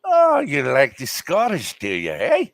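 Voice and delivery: slow put-on Scottish accent. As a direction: tease in a drawn-out Scottish accent. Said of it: "it's Scottish accents that get me".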